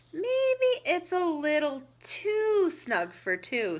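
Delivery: high, loud, slow hesitant tortoise. Acted. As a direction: draw it out slowly as a doubtful tortoise. Tortoise voiced in read-aloud.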